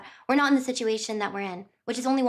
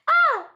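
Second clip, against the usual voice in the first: excited yelp; 7 dB louder than usual. Real, not acted. Exclaims at chapter cliffhanger.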